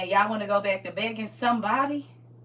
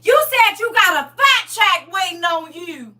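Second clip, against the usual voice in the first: excited; 8 semitones higher, 11 dB louder than usual. Real, not acted. Excited retelling of good news.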